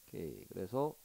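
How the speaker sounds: normal talking voice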